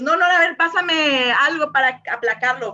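high, loud mock panic over a loose hair; hammed-up fussy alarm; alarmed neat freak. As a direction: play the fussy type gasping for something to flatten it. Green person panics over messy hair.

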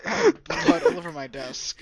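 high laugh through words; cracking up mid-sentence. Real, not acted. Laughing at a misheard word.

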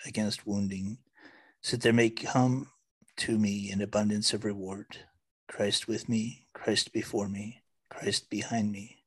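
soft, measured litany; quiet, reverent litany; prayer recitation. Acted. Recites Saint Patrick's breastplate.

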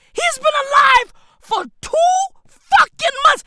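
high, incredulous comic yell; exasperated shout. Acted. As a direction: shout it in disbelief. Incredulous rant about his baby.

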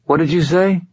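high, taken-aback female caller; woman's voice. A narrator's reading. Woman on the phone to a rude man, high puzzled voice. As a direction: pitch it up as a puzzled question. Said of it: "said a woman's voice".

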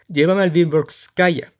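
más alto y lento, ordenando; orden alta. Acted. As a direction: manda con voz alta y pausada. Militar ordena destino. (orden en voz alta)

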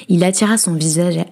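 plain reading voice

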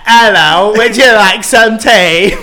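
high, loud, slowed British parody; mock British accent. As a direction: echo a friend's bad British accent. Mimics friend's British accent demo.

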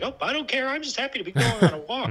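high, carefree voice for the dog; high-pitched imitation of a contented pup; happy dog. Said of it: "But she was like".

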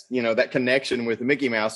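ordinary delivery

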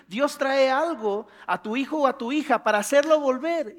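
ordinary talk, nothing marked

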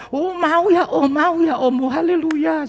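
high, bright coaxing; coaxing gentle. Retold or imitated. Pastor coaxes the sick man to accept prayer, high and bright.

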